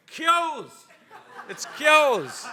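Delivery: high, loud exclamation; emphatic call-out. Real, not acted. Settling the audience's best-pint debate, loud emphatic call-out. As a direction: shout the pub name high, then drop. Calling out the winning pub name.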